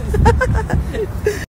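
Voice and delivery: a short high laugh; bright laugh. Real, not acted. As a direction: laugh it off, tickled. Laugh after photo.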